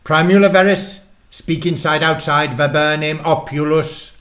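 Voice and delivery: high, loud, theatrical wizard's spell; loud mock-Latin conjuring; bizarre incantation. A narrator's reading. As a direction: chant the spell with big dramatic force. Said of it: "whispered a big, bizarre incantation".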